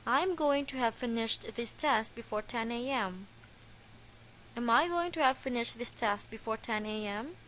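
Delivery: normal talking voice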